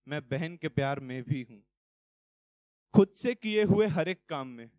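emphatic, rhythmic verse; poem recitation. Acted. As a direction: declaim each line in a driving, measured cadence.

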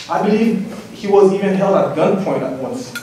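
normal talking voice

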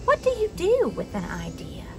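plain reading voice

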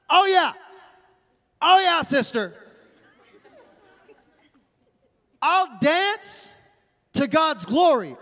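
sassy, defiant retort in emphatic bursts; spicy comeback. Acted. David answering his scornful wife, spicy and defiant. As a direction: throw it back with swagger, stretching key words. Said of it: "Real spicy".

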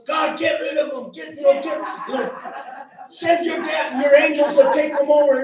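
high, loud pleading shout to God; hammed-up cry for angels to smite them; mock desperate prayer shout. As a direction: yell the prayer, half-joking. Frantic prayer to get rid.

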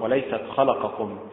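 normal talking voice

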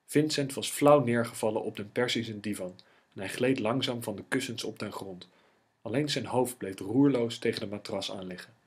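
ordinary delivery

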